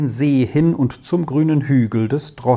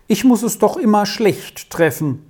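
higher, fretful outburst; a flustered visitor complaining, higher pitched; agitated; 8 semitones higher than usual. A narrator's reading. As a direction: blurt it out, flustered. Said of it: "sagte sie aufgeregt".

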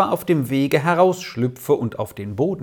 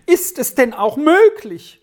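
high, louder cry of dismay; aghast mother, voice leaping up; horrified exclamation; 10 semitones higher, 4 dB louder than usual. A narrator's reading. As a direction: cry out in shocked disbelief. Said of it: "rief die Mutter im höchsten Schrecken aus".

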